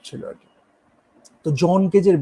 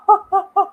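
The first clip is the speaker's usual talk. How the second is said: high breathy ha ha ha sounds, half laughing; hammed-up sighing ha ha ha; mock orgasmic gasps; 4 dB louder than usual. Shown as a demonstration. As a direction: imitate the gasping vocal sounds, much higher. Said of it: "orgasm হলে যেন হা".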